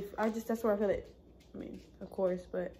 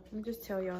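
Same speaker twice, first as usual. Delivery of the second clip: normal talking voice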